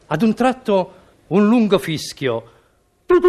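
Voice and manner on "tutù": train whistle toot. Acted.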